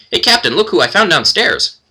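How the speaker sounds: cheerful kender. Acted. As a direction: announce the visitors brightly.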